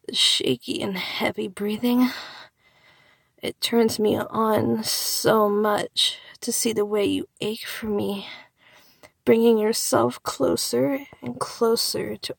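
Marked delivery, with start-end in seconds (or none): none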